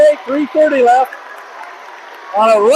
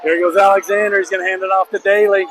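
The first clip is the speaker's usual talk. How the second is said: ordinary talk, nothing marked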